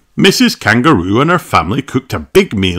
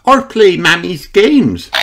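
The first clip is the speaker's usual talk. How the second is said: high, lively piglet voice; Peppa; 9 semitones higher, 4 dB louder than usual. A narrator's reading. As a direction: read it as an excited little character, pitched up. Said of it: "says Peppa".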